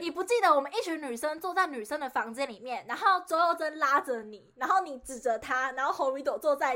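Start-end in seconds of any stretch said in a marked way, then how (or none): none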